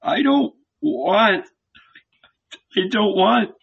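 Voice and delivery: high tearful pleading; weeping, begging not to be left; weeping murmur. A narrator's reading. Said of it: "he murmured".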